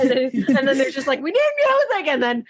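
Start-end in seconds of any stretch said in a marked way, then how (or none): 1.2-2.1 frantic demand. Retold or imitated. Acting out characters' frantic demand.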